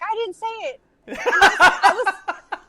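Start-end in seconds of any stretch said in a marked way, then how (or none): 0.0-0.8 put-on high little-kid voice, half laughing; son's denial. Son denying he cussed, high childish voice. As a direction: deny it like a caught kid. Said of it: "and he's like".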